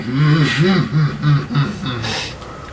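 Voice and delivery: closed-mouth menacing chuckle; grinning chuckle. Acted. Ogre thumping his club at the players, smug humming chuckle. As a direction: chuckle through a shit-eating grin. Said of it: "just this shit-eating grin, going".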